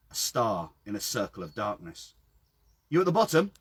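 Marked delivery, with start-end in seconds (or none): 2.9-3.5 calling down. A narrator's reading. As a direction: call down the well. Calling down into a well.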